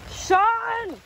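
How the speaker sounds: high call of a name. Real, not acted. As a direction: call out across the trail. Calling out to friend.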